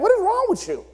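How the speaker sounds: high exasperated question; exasperated partner. Acted. Partner confronting someone who vanished for days. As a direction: throw up your hands at them.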